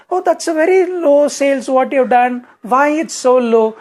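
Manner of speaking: high, loud, slowing sneer; scornful boss. Retold or imitated. Komudi belittling Anand's sales, high, loud and scornful. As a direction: look down on his numbers like a harsh manager. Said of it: "And she'll say".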